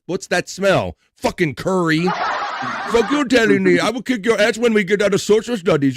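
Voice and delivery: high, loud mock bully threat; hammed-up playground threat; mock school fight. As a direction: schoolkid picking a fight over curry, mock angry. Bully and kid quarrel acted.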